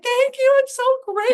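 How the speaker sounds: high, loud, overwhelmed cry of thanks; nearly shouting thank you through tears; tearful gratitude. Retold or imitated. Retelling her vision, she cries out her thanks loudly and high. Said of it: "And I'm, like, crying".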